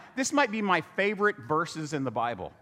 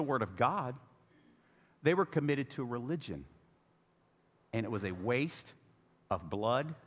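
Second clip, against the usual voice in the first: solemn hush; 7 semitones lower, 6 dB quieter than usual. Real, not acted. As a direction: drop to a grave near-hush. Solemn preaching point.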